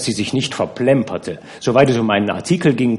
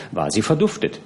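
ordinary delivery

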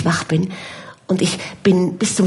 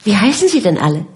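ordinary delivery, nothing marked

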